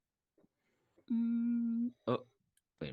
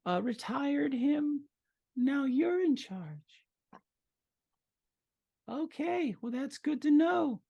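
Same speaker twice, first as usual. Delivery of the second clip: female goblin voice; 13 semitones higher than usual. Acted. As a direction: lay out the terms sweetly.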